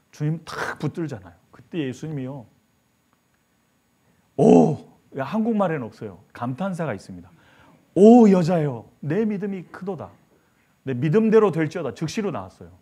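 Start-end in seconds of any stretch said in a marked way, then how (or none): 4.4-4.8 loud drawn-out oh of wonder; amazed exclamation. Acted. Jesus marvelling at the woman's faith, a loud oh. 7.9-11.9 high, loud, ringing praise; loud proclamation. Acted. Jesus praising the kneeling woman's faith, loud and ringing.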